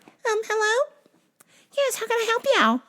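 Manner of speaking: very high, squeaky put-on clerk; disguised phone voice. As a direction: disguise yourself with a tiny falsetto. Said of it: "I'm gonna change my voice every time you call".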